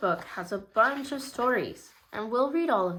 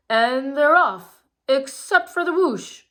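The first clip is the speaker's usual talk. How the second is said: loud, slow, higher announcer call; race announcer; 5 semitones higher, 7 dB louder than usual. A narrator's reading. Ron the announcer calling the race start, loud and drawn out. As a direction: boom it out like a race commentator. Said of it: "said Ron Rapidfire, the announcer".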